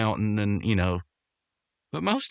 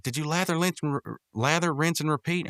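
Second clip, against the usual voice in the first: stumbling over words; tripping on the words; stuttering; 7 semitones higher than usual. Real, not acted. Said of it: "I'm stuttering a lot today".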